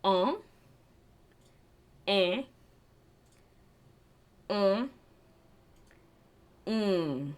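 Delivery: um, eh, um, mm said through the nose; drawn-out nasal vowel sounds. Shown as a demonstration. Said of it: "The nasal vowels are".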